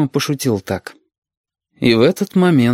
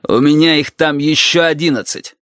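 raised, higher-pitched retort; barking; 5 semitones higher, 3 dB louder than usual. A narrator's reading. Valentin protests to the captain about the children, barking. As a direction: bark it at the officer, moderately loud. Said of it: "гаркнул Валентин".

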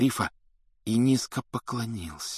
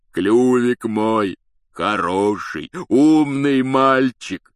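loud, slow, drawn-out praise; joyful bawling; 2 semitones higher, 6 dB louder, much slower than usual. A narrator's reading. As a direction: bawl fondly at your beloved beast. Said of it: "вопил Хагрид".

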